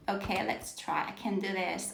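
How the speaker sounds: barely audible self-encouragement while fiddling with the screen; quiet self-talk. Real, not acted. Teacher struggling with the screen, murmuring softly to herself. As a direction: mutter it softly to yourself.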